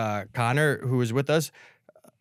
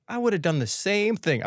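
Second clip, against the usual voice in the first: higher-pitched start, laughing by the end; put-on understanding; 8 semitones higher than usual. Neighbor answering her apology, mock understanding. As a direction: act understanding and fail to keep it straight. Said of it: "I have to act, like, uh, so understanding".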